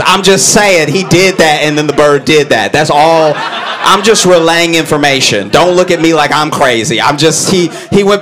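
ordinary delivery

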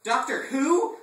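high, loud incredulous outburst; hammed-up outraged son; indignant cry. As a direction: shout it in disbelief. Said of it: "I cried".

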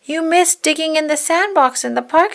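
high, teasing little puppy; brother puppy. Acted. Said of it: "said his brother".

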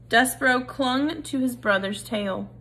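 ordinary delivery, nothing marked

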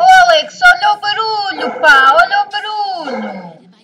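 loud, exasperated shouting; angry yelling. Real, not acted. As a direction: yell across the room at him. Yelling at Alex about noise.

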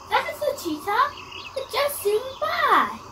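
high, amazed exclamation; excited. Acted. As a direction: marvel at what just passed. Cheetah spotted.

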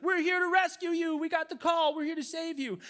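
urgent, high-pitched rescue announcement; rescuer calling out. Acted. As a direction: call down to the man in the water. Coast guard rescuer calls out.

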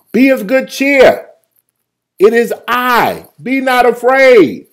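high, loud, drawn-out proclamation; Jesus calling out. Acted. Jesus speaks to frightened disciples, a loud high call. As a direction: call it slowly across the water as Jesus. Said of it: "Jesus spoke unto them".

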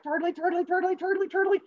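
very high, squeaky, even chant; little cartoon turtle chugging along; scurrying turtle. Turtle races as fast as he can, high squeaky chant.

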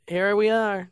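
cheesy pose line. Shown as a demonstration. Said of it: "you know, like".

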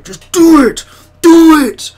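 hugely loud, high-pitched yell; motivational-video shout. Retold or imitated. Imitating a bloke in a viral video, shouted at full force. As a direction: bellow it like an over-the-top motivator. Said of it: "video of him just going".

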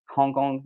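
quietly retry Hong Kong, breathing out the H; sounded H. Shown as a demonstration. French speaker practising a terrible H in Hong Kong, softly sounded H. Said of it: "Like, the H is terrible".

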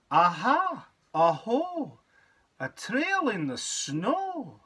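bright exclaiming storybook voice; eager little creature exclaiming; child calling out. Acted. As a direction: call out boldly into the snowy wood. Child reads Gruffalo's child calling out.

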